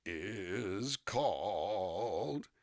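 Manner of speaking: very slow, wavering stretched words; drawn-out stalling. Real, not acted. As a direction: stretch each word out while you search. Stalling while finding app name.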